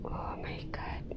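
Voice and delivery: scared, breathless whisper; frightened whisper in the dark. Real, not acted. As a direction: gasp it with no voice. Spooked in the dark.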